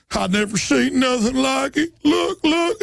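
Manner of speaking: high, amazed dumb cop; dim cop impression. Host voicing cops gawking at a pocket constitution, high. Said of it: "And they're like".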